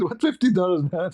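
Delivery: high-pitched mock protest; comic indignation; retold upset. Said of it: "I get upset".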